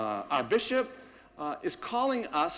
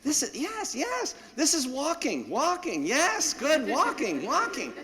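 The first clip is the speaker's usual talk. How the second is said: much higher, gushing yes-yes to a dog; high gushing praise for a puppy walking nicely on the leash; excited puppy praise; 9 semitones higher than usual. Retold or imitated. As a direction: cheer the puppy on like he just won.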